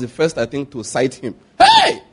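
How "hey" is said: sudden high shout of alarm. Retold or imitated. Maid spotting the scratched car, shouting in alarm. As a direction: yell out in shock at the scribbled car. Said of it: "she shouted".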